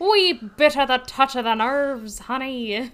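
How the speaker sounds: playful hint of a Scottish accent, calling her honey; mock Scottish accent. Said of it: "it kinda sounded Scottish".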